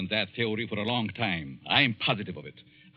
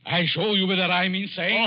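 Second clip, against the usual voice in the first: building from tight to a louder, higher angry shout; angry outburst; 8 semitones higher, 5 dB louder than usual. Acted. Insulted professor rounds on the editor, rising angry shout. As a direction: blow up at him, rising to a shout. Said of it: "Oh, calm down".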